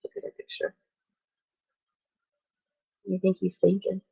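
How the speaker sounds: ordinary delivery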